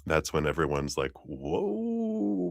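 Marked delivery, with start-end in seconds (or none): 1.2-2.5 soft, very high drawn-out reaction; mock astonishment. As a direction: imitate onlookers stunned by a shove. Acting everyone reacting to a shove.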